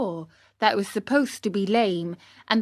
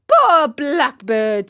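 loud, high, drawn-out pity; pitying lament; 5 semitones higher, 8 dB louder, slower than usual. Acted. Pinocchio to the cat after the killing, pitying. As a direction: lament it slowly, with feeling. Pinocchio mourns the eaten bird.

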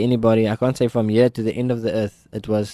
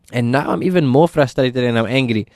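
ordinary talk

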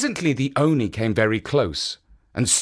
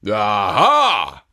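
ogre cry; 4 semitones lower, 6 dB louder than usual. Acted. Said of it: "cried the Oni".

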